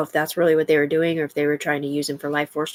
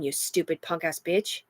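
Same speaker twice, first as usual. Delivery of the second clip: ordinary talk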